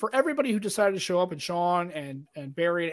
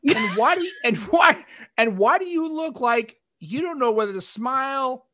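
high, pitched-up disbelief, a question tumbling out; mock baffled friend; 7 semitones higher, slower than usual. Retelling his friend's teasing about the photo, a high incredulous whine. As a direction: play the friend who can't believe this photo.

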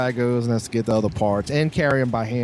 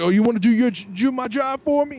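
retold screaming; 12 semitones higher than usual. Worker turns on the man correcting him, mock screaming. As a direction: imitate an offended worker starting to scream. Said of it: "He starts screaming at me".

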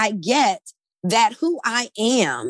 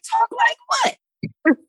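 much higher, slow, stretched-out mock disbelief; incredulous disbelief; much slower than usual. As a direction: drag it out, baffled and very high. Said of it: "And I was like".

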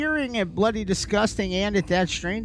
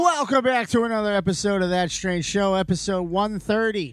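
show announcer; 5 semitones higher than usual. Acted. Host's wacky show opener.

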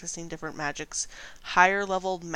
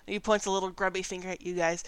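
ordinary talk

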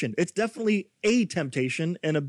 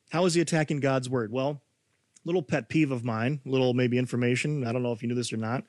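normal talking voice

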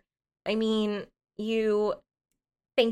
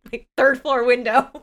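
louder, laughing through the words; 3 semitones higher, 7 dB louder than usual. Real, not acted. Laughing to her friend about jumping out. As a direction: joke it out mid-laugh.

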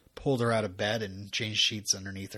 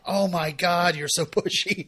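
mock exasperation; 5 semitones higher, 4 dB louder than usual. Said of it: "joking, it's not".

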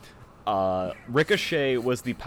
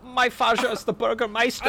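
high, put-on German villain voice; mock German accent; 11 semitones higher, 3 dB louder than usual. Playing the mouse's father in a mock German accent. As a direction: pipe up as a squeaky German mouse.

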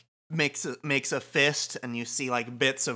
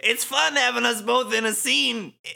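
high, loud, swaggering character voice; hammy villain parody; 11 semitones higher, 6 dB louder than usual. Pretend. [name] joking with Kubo in the scene, hammy. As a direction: overplay the villain with amusement.

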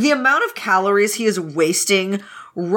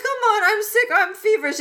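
milk the fever for sympathy; whiny sick pleading; 11 semitones higher than usual. Retold or imitated. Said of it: "Wei Wuxian's like".

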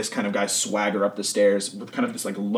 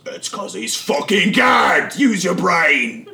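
loud, slow, high yell at an idiot; exasperated shout; 6 semitones higher, 5 dB louder, much slower than usual. Acted. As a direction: spell it out to a fool, yelling. Boss snaps at dumb henchman.

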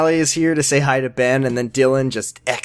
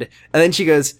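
ordinary delivery

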